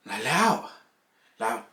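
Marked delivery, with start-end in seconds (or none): 0.2-0.7 incredulous. Retold or imitated. Disbelief at email.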